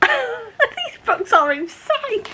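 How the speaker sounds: high, slow, laughing through the words; laughing disbelief. Real, not acted. Reacting to a wild book twist for viewers, laughing.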